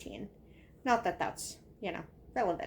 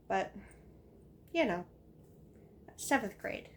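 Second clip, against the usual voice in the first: normal talking voice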